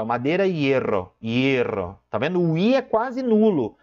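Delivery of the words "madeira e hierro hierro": hierro said as ero, the I nearly gone; sound of the word. Shown as a demonstration. Teacher repeating hierro so students hear the weak I. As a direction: let the I of hierro vanish. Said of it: "no próprio som da palavra".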